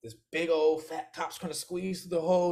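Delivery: normal talking voice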